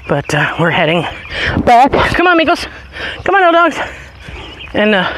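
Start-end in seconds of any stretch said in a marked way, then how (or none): 1.7-3.8 high, loud call to the dogs. Real, not acted. Owner calling dogs home, high loud call. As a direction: call them along, pitched up.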